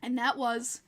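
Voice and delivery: ordinary talk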